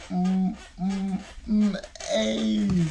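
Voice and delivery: hammed-up moans. Imitating himself during sex.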